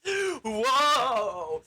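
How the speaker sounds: hammed-up amazement; startled mock gasp. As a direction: react in shock to the new body. Gasps at her new body.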